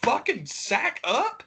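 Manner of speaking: high, slowed scolding; exasperated retort. Retold or imitated. Fan scolding a complaining wrestler, high exasperated. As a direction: snap it back incredulous and high. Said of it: "I'm like".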